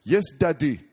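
put-on high, loud small-girl voice with a Ugandan accent; little Ugandan accent. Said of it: "She had this really strong accent".

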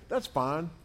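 ordinary talk, nothing marked

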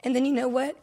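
ordinary delivery

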